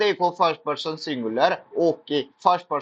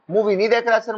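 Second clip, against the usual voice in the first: raised, higher voice so the whole house hears movie नहीं देख रहा; loud for family to hear; 6 semitones higher, 3 dB louder, faster than usual. Pretend. Student saying it loudly so his family knows he studies. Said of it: "घर वाले को पता होना चाहिए".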